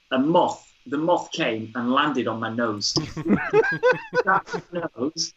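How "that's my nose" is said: laughing. Real, not acted. Laughing after the moth lands.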